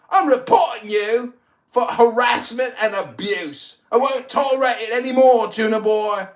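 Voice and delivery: furious mock English accent. Raging at a threat on Clubhouse, in a fake English accent. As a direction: blow up at him in a put-on English accent. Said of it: "my English accent is so mocking".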